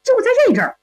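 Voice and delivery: ordinary delivery, nothing marked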